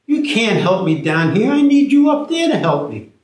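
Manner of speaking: high incredulous outburst; exasperated. Acted. Tommy shocked the stranger jumped into the ditch, high loud disbelief. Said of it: "Tommy's saying".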